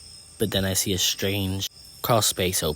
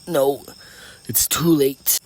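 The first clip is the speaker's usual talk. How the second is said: strained, higher injured voice; wounded grandfather; 7 semitones higher, 8 dB louder, slower than usual. A narrator's reading. Grandfather bleeding on the ground, strained reply. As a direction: force the words out after coughing.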